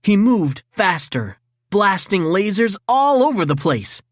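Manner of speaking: plain reading voice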